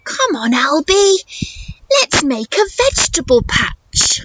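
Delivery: lightly voiced cheerful mum; mum's voice. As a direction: coax him like a gently upbeat mother. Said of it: "But Mum says".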